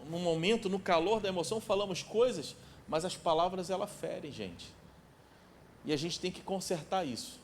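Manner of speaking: usual speaking voice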